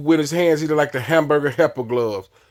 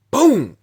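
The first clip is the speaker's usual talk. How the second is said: sharp high shouted boom; a loud mock impact noise; imitated impact boom; 10 semitones higher, 5 dB louder than usual. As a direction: punch out the kick sound. Imitating a kick landing.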